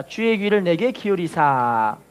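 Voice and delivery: very slow, deliberate recitation to repeat; slow call and response. Shown as a demonstration. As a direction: draw every word out for the church to follow. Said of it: "따라합시다".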